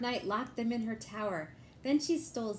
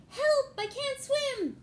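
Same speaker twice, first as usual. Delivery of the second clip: much higher, loud mock panic; drowning cry; 6 dB louder, slower than usual. Dark Knight in the moat crying for help, high panicked. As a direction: flail and yelp for help.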